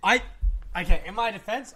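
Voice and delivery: loud defensive. Real, not acted. Defending himself against teasing.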